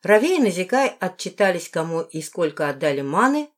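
ordinary delivery, nothing marked

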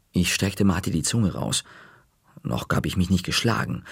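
ordinary delivery, nothing marked

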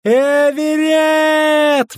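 very high, long-drawn howl; anguished wail. A narrator's reading. Matron seeing boy at knifepoint, howling. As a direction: wail the child's name. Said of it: "взвыла Матрона".

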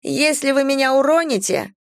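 high, warning character voice; woman in peril. A narrator's reading. Vanetta warns rescuers from the darkness, high voice. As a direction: warn them in a high voice. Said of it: "послышался из темноты голос Ванетты".

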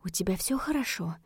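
softly asking if he is all right; a hushed, careful check-in; cautious, soft. Acted. Dina gently checking on Vince sitting on the floor, cautious and soft. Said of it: "позвала осторожно".

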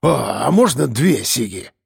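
slow, hopeful boozer voice; perked-up drunkard. A narrator's reading. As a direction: perk up at a free smoke. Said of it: "оживился алкаш".

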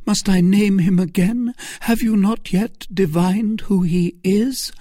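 high, loud reproach; indignant Milady. Acted. Milady pressing Felton indignantly. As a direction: press him with the answer.

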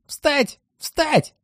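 squealing high-pitched command; shrill shouting voices. Acted. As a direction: shriek the wake-up call. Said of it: "визгливые голоса всё выкрикивают".

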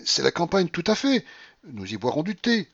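high-pitched last coaxing; desperate. A narrator's reading. General tempting his aunt with countryside tea, desperate. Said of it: "absolument désespéré".

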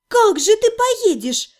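high, slow needling question; sarcastic. A narrator's reading. Heroine mocking the healer's plan, sarcastic. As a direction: ask with a jab, slowly. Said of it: "язвительно спросила я".

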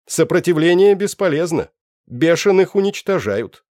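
высокий громкий механический голос; чеканит, как бездушный автомат; голос машины-переводчика. Acted. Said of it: "произнёс словарь". (механический голос переводчика)

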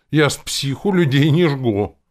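loud, high protest; indignant retort. A narrator's reading. Captain rebutting the hero's warning, indignant. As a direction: hit back indignantly.

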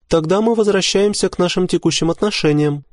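very high female character voice; composed wife. A narrator's reading. Wife concluding talk with rejected husband, composed. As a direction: close the matter in a high, even voice.

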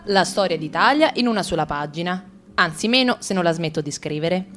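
ordinary talk, nothing marked

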